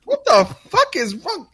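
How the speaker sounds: very high falsetto fuss; mocking prissy kid. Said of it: "I'm like".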